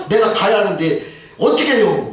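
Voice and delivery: high, mildly exasperated grumble; retold complaining. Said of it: "막 또 불평을 또 하더라는". (어쩌냐고 불평하는 높은 목소리)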